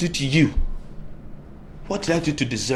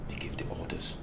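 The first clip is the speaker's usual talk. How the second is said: stunned whisper; 10 dB quieter than usual. Acted. Stunned disbelief.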